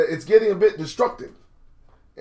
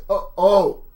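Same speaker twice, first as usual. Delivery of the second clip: ordinary delivery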